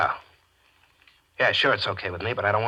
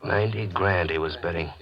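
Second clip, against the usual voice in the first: ordinary talk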